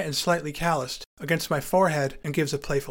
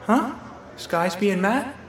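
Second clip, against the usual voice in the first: confused rising "Huh? Skysby and Matt?" with "Matt" lifted as a question; a friend mishearing the name, baffled; puzzled mishearing; 5 semitones higher, slower than usual. Retold or imitated. Puzzled rise on "Matt". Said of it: "friend mishears the name".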